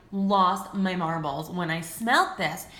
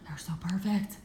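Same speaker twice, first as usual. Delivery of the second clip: breathy awed whisper; real awestruck whisper; 5 dB quieter than usual. Real, not acted. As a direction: hush it like a secret treasure. Swooning over the scent.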